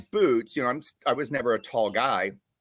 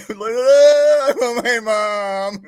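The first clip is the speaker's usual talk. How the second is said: panicked cry; 10 semitones higher, 9 dB louder, much slower than usual. Retold or imitated. Recruit panicking at boot camp.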